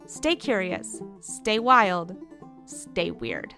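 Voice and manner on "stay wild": plain reading voice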